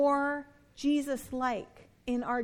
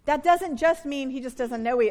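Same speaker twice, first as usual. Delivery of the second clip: usual speaking voice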